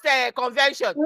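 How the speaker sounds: normal talking voice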